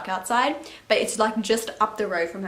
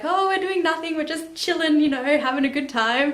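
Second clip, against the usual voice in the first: high, breezy mock-casual; a hint of put-on innocence in a voice pitched up; faked innocence; 6 semitones higher than usual. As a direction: act like nothing's going on, lightly. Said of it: "we acted like".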